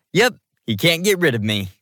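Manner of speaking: lighthearted teasing; playful. A narrator's reading. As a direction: say it with a cheeky bounce. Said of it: "Titus said playfully".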